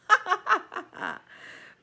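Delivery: high cackling rat laugh; gleeful rat cackle from the sidelines; loud villain laugh. A narrator's reading. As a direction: throw back your head and cackle. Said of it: "Botticelli laughed".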